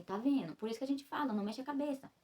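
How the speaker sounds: imitating a nurse's mild scolding; retold reproach. Nurse's reproach voiced in story.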